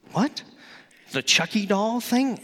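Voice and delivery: shocked at the idea; teen balking at a horror movie; mock surprise. Buddies' suggestion retold.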